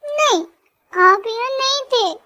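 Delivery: put-on high childish voice insisting no; a pouting toddler contradicting his father; sulky little boy. Kuku sulks at papa. (ऊँची आवाज़ में रूठा बच्चा)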